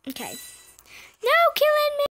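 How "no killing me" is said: squealed plea in the game; high-pitched protest. Real, not acted. Protesting being attacked in game.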